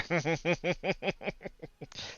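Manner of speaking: high villainous cackle; evil chuckle. Acted. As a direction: laugh like a gleeful villain. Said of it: "that was a good villain laugh".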